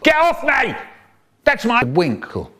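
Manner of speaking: high roared protest; a child roaring for help. Acted. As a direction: yell at him to get off. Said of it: "my brother is roaring".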